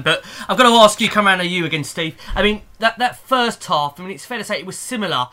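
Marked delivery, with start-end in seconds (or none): 0.0-4.0 loud host question. Real, not acted. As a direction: call across to Steve, loudly. Host turns to Steve.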